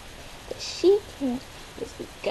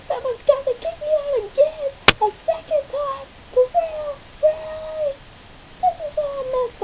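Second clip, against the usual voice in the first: shrieking jackpot winner; 6 semitones higher than usual. Acted. Kicked out, distraught.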